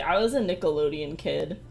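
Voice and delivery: usual speaking voice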